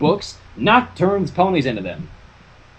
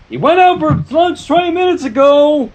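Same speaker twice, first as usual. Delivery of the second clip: calling out; 10 semitones higher, 4 dB louder than usual. Acted. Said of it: "called from his desk".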